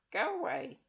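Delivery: high, grumpy dismissal; sulking witch sending them away; sour old witch. Acted. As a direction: brush them off sourly. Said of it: "she said sourly".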